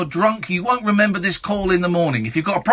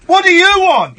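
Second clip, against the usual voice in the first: angry; 9 semitones higher, 5 dB louder than usual. Real, not acted. Host snaps at abusive caller.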